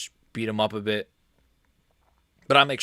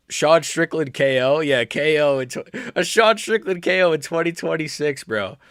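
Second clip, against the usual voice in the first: mock fan voice; 2 semitones higher than usual. Mocking Strickland fans' prediction.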